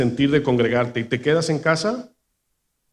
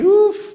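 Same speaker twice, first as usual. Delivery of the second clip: high exclamation; 6 dB louder than usual. Real, not acted. Recalling how easy sinning was.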